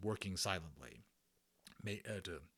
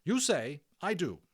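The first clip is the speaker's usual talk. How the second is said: matron instructing; 8 semitones higher, 7 dB louder than usual. Acted. Matron teaching phrase.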